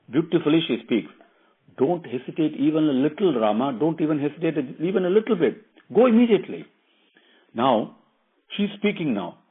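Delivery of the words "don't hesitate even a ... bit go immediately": higher, insistent wifely urging; urging wife Sita. Acted. Wife telling Rama not to hesitate, urgent and higher. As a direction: push him to go, rising to a loud, high command.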